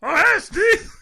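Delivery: very high, loud strangled yelp; Bobcat. Acted. Showing co-host his go-to Bobcat impression, manic high Bobcat voice. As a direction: squeal it out loud and far above your own voice. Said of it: "obviously, I have Bobcat".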